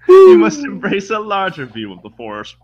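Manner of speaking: high, loud, hammed-up villain impression; Palpatine. Podcaster quoting the film to co-hosts, hammed-up villain voice. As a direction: put on the scheming Sith mentor, big and loud. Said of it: "that scene where Palpatine's like".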